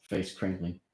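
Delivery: plain reading voice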